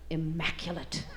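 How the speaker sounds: low, soft deadpan reveal; soft, low comic payoff; hushed punchline. A narrator's reading. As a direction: drop the word low and quiet. Punchline set up by slow build.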